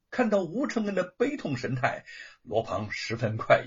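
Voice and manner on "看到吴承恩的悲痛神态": usual speaking voice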